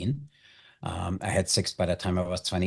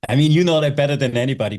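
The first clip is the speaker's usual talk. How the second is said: laughing through the words, higher and louder; 8 semitones higher, 9 dB louder, faster than usual. Real, not acted. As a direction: burst out laughing as you tease him. Laughing while teasing host.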